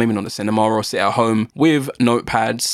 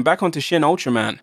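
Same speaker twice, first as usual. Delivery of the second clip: usual speaking voice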